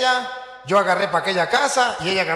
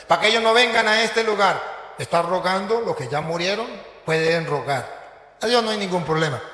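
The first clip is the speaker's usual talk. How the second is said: ordinary talk, nothing marked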